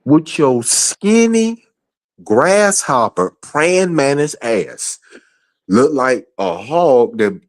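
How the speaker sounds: mock her with a slow high sneer; angry high taunt. Real, not acted. Taunting Chandel, asking why she watches them, in a high slow sneer.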